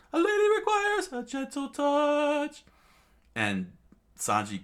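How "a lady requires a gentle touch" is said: very high, loud falsetto; squeaky gallant line. Acted. Sanji saving Nami, in a high proud declaration. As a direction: declare it grandly in a cartoon voice.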